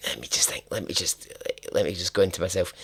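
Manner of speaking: plain reading voice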